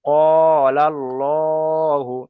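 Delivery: কলাল্লাহু very slow and chanted, long vowels; drawn-out recitation. Shown as a demonstration. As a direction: stretch কলাল্লাহু with a heavy, full 'lo'. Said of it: "একালি পরিমাণ টান দিয়ে".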